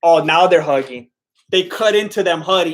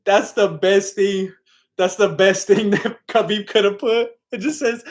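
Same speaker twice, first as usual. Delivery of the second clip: laughing; 3 semitones higher than usual. Real, not acted. Laughing at the tweet.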